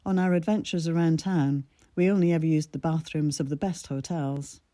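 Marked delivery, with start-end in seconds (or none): none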